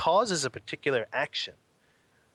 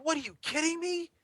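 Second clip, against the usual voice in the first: very high, acted disbelief; high-pitched astonished outburst; incredulous. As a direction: play the stunned monk, voice shooting up. Monk learns who they were.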